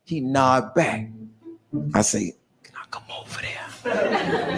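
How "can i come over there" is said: slow, very soft whisper; timid whisper. Acted. New inmate asks Cece to approach, timid whisper. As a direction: ask permission almost without voice.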